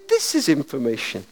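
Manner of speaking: a lightly voiced switchboard operator. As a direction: answer like the telephone operator. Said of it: "And the voice said".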